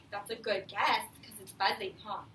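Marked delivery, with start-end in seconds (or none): none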